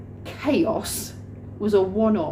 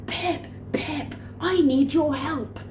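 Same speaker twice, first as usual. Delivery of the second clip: high-pitched urgent call for help; panicked boss; 6 semitones higher, slower than usual. Retold or imitated. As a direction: mimic a flustered man calling out, high. Acting Rob bursting in panicking.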